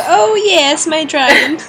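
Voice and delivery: high, loud hammed-up delight; mock enthusiasm. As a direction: play it up as a silly joke. Mocking the dragon idea. (överdriven låtsasglädje)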